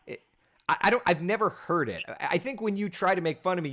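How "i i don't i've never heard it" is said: ordinary delivery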